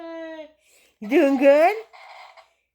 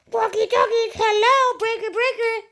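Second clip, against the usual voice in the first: high playful radio call; over cb radio; 9 semitones higher than usual. Pretend. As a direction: call out higher like a CB operator.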